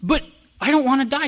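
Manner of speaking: high, loud protest; pleading protest. Retold or imitated. Son protesting to mom that he doesn't want to die, high and loud. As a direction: squeak out the protest.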